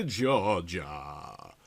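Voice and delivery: ordinary delivery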